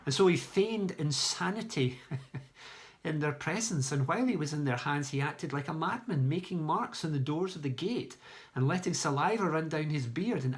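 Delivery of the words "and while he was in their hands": plain reading voice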